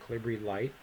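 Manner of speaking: normal talking voice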